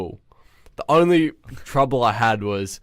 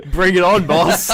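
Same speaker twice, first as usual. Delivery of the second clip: cocky mock challenge; 6 semitones higher, 6 dB louder, slower than usual. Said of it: "I'm like".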